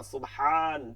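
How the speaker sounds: সুবহান with a held, stronger "haan"; emphatic long vowel. Shown as a demonstration. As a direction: lean on and lengthen the end of সুবহান. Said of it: "এর মধ্যে কি ছিল?". (জোর দিয়ে টেনে বলা)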